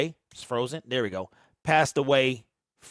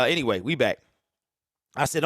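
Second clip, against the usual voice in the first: ordinary talk, nothing marked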